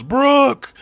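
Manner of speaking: very high, loud shout of a name; calling out. Acted. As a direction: yell his name across the warehouse. Levin bursts in, calling Brooke.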